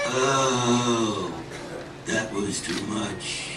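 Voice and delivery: low, soft groggy fox, drawn out; stuffed fox groaning for a nap; sleepy stuffed fox. Acted. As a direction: sigh it like a belly too full. Overstuffed fox after eating.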